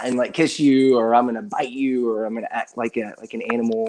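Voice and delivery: ordinary talk, nothing marked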